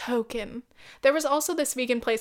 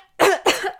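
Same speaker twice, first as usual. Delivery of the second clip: choking cough, high and loud; sudden coughing fit; 8 semitones higher, 8 dB louder than usual. Real, not acted. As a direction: caught off guard, hacking.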